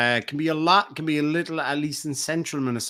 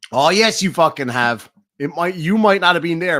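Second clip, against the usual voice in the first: emphatic insistence; 3 semitones higher, 7 dB louder than usual. Real, not acted.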